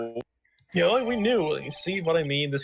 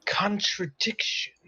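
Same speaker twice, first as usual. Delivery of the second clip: character voice; 9 semitones higher, slower than usual. Acted. Character voice in game read.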